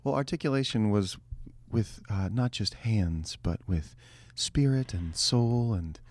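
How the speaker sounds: soft, slow radio host voice; calm public radio delivery; NPR voice. Acted. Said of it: "I'm just doing the N P R voice".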